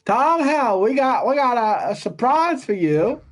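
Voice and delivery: high, folksy mock voice of the game boss; hammed-up high impression, slowing toward the end; Todd Howard impression. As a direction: pitch it way up in an overdone country twang. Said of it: "That's how he talks".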